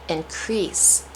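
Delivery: increase said in-CREASE; stress on second syllable. Shown as a demonstration. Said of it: "going to say it differently".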